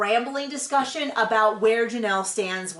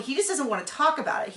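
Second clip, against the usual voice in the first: ordinary talk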